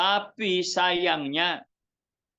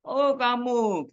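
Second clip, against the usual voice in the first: surprised greeting; 7 semitones higher, slower than usual. Retold or imitated. Surprised greeting of old friend.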